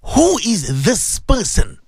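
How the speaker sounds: very slow, weighty interrogation; ominous, drawn-out question; questioners in the grave. Acted. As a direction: stretch each word out with grave weight. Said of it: "They're gonna ask you".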